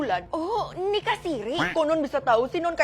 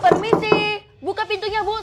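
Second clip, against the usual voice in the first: calling out; 7 semitones higher, 7 dB louder than usual. Acted. Calling at the door.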